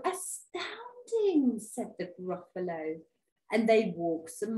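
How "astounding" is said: high, drawn-out wonder; astounded monster. Acted. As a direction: stretch the word in sheer amazement. Gruffalo is astonished.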